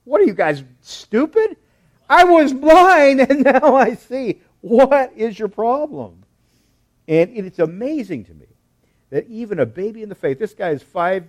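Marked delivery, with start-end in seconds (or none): 0.0-6.2 loud, high, incredulous outburst; fed-up shout at dense questioners; exasperated blind man. Acted. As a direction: blurt it out in loud exasperation, slowing for emphasis. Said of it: "I can hear him kinda like".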